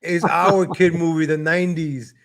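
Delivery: ordinary talk, nothing marked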